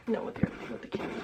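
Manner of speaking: ordinary talk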